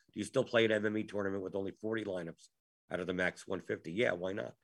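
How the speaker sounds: ordinary talk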